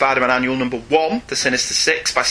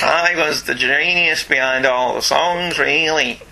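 comic Liverpool musician voice. Acted.

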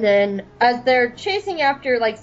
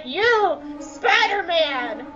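high, drawn-out heroic challenge; Rex; 7 semitones higher, much slower than usual. Acted. Rex confronting the spiders, mock heroic. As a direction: call out the enemy, stretching the words. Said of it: "he's like".

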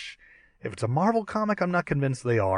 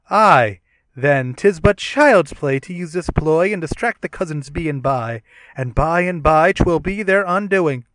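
booming Shakespearean hero, louder; archaic heroic voice; 4 semitones higher, 6 dB louder than usual. Acted. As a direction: declaim like a noble thunder god. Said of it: "we can do a dramatic reading".

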